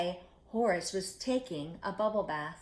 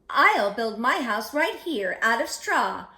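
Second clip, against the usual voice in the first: louder, bright cheer; cheerful pig; 4 semitones higher, 8 dB louder than usual. Acted. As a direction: cheer it out happily. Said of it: "Horace cheered".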